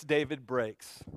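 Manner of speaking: slow it down and let it sink; hushed and broken. A narrator's reading. Preacher repeating that David breaks, slow and hushed.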